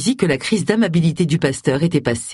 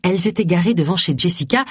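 ordinary delivery

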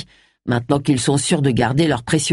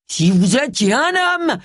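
higher and slow, seething; raging; 8 semitones higher, slower than usual. A narrator's reading. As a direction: hold back fury, pitched up. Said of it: "ragea Harry". (colère contenue, voix plus aiguë et lente)

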